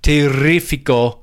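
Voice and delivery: slow Terrifico with a rolled R. Shown as a demonstration. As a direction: trill the R, slow and careful. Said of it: "I can't do it".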